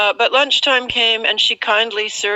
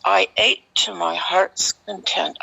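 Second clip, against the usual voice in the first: ordinary talk, nothing marked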